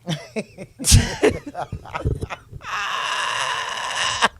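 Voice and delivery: long, helpless cackling fit; real laughter. Real, not acted. Telling a friend grandpa's blunt words, long real laughing fit. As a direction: break up laughing and keep going.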